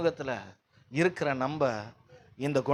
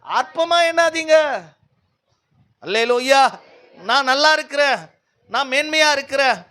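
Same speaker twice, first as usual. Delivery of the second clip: raised, emphatic preacher's voice; forceful preaching; 9 semitones higher, 7 dB louder than usual. Real, not acted. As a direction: drive it home to the congregation.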